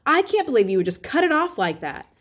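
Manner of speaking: snotty. A narrator's reading. Sister scolding Augie before slamming his door, snottily. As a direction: scold him like a snooty older sister. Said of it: "she added snottily".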